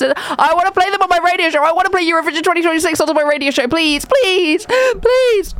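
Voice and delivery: put-on high-pitched childlike pleading, building to a squeal; mock begging. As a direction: beg in a hammed-up tantrum. Mock tantrum begging for songs.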